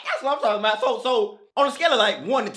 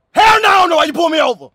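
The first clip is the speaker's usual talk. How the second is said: angry shout; 4 semitones higher, 7 dB louder, faster than usual. Acted. Rude take on the stop.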